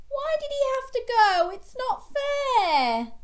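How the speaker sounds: high, loud whining; child shark wailing at Mum, high and loud; whiny protest. A narrator's reading. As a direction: wail it's not fair. Gilbert protesting his loss.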